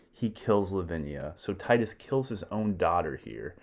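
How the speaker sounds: usual speaking voice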